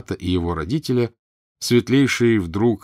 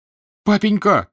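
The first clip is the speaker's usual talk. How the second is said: much higher, loud, drawn-out call; boy crying out; 13 semitones higher, 7 dB louder than usual. A narrator's reading. Mitya rushing to his father, high desperate cry. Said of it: "закричал Митя".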